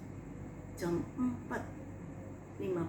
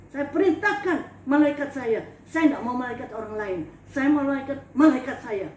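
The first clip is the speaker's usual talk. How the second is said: louder, demanding God send her angels; commanding challenge; 6 dB louder than usual. Retold or imitated. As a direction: order heaven around, loud and defiant. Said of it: "Saya tantang Tuhan begitu".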